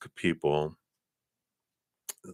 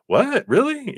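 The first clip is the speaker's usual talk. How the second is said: high squeaky delighted disbelief; amazed disbelief; 5 dB louder, slower than usual. Retold or imitated. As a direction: gasp it out, thrilled and stunned. Acted stunned reaction to offer.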